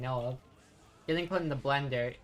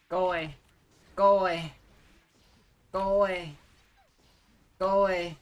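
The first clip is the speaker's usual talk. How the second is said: louder and higher go away; shouting go away; 6 semitones higher, 7 dB louder than usual. Real, not acted. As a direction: yell it at the opponent. Repeatedly telling opponent off.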